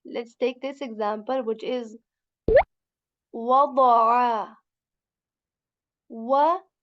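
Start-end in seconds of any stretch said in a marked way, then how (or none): 3.3-6.7 "wada'a" with a heavy full-mouth "da"; heavy dad sound. Shown as a demonstration. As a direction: weigh down the middle of "wada'a". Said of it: "example of the dad letter".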